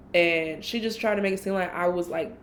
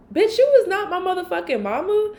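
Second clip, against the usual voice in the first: high, loud exasperated retort; high loud retort to a controlling friend; exasperated outburst; 10 semitones higher, 6 dB louder than usual. Retold or imitated. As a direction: fire it back high and loud. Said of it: "I'm just thinking in my head".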